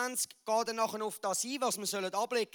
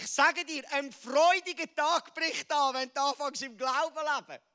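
rousing proclamation; 6 semitones higher, 7 dB louder than usual. Acted. Preacher promising the congregation a joyful day, jubilant and loud. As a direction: lift the hall with a jubilant proclamation.

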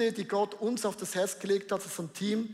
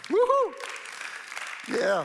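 jubelnder Ruf; 10 semitones higher, 4 dB louder, much slower than usual. Real, not acted. Cheering after testimony video.

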